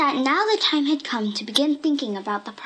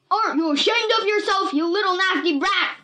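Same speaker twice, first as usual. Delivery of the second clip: much higher, loud furious scolding; furious yell; 9 semitones higher, 3 dB louder than usual. A narrator's reading. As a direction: scream at the brat. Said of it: "he screamed".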